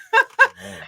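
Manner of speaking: bursts into a high giggle. Real, not acted. Wife giggling shyly about her husband. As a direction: giggle sweetly, embarrassed.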